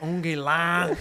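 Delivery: a hint of a bad French accent, as if on the phone; imitating Peter McAllister struggling with French on the phone. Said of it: "He's like".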